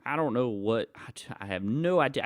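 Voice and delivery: normal talking voice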